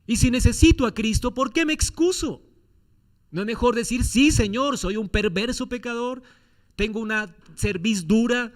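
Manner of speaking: rising pulpit fervor; fervent preaching. Acted. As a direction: press the congregation with each question. Preacher builds a fervent plea.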